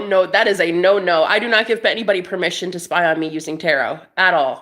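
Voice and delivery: ordinary talk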